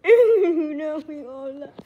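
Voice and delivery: loud burst of laughter into the words; laughing hard. Real, not acted. Player professing love to friends, laughing hard. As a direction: crack up while saying it. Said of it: "I'm laughing so hard right now".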